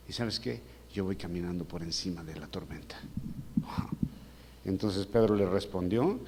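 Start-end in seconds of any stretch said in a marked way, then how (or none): 0.1-3.0 low, hushed, calm assurance; quiet reassurance. Acted. Jesus to disciples in the storm, low quiet reassurance. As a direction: say it softly and low, very sure.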